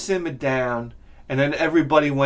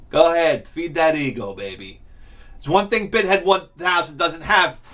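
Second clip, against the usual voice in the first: normal talking voice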